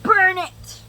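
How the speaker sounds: high, loud shout; shouted suggestion. Retold or imitated. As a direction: yell it out. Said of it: "my sister was like".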